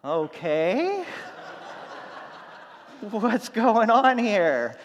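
laughs through a slow, loud question; laughing disbelief. Retold or imitated. Teller quotes her own surprise.

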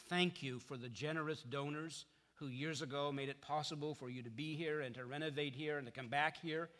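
ordinary delivery

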